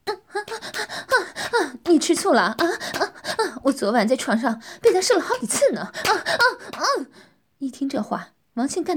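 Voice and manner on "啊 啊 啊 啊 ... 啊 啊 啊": high, louder teasing moaning speech; teasing moans. Acted. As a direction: taunt him between moans. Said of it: "呻吟着说". (呻吟着挑逗)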